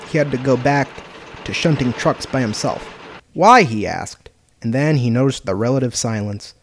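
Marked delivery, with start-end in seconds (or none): 3.3-3.6 high, loud one-word cry; startled question. Acted. Maybach learns he must shunt alone, loud surprised protest. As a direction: cry it out, pitched sharply up.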